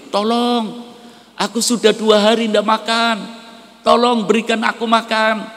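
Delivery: high, pleading cry for food at the door; starving beggar pleading. Acted. Starving beggar at the rich couple's door begs, high and pleading. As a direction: beg weakly like a man starving two days.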